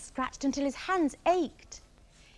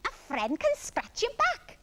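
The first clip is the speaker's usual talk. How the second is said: high, drawn-out parrot voice; Parrot cackling; 12 semitones higher, 3 dB louder than usual. A narrator's reading. Parrot offering Iggy help, high cackly voice. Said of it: "cackled Parrot".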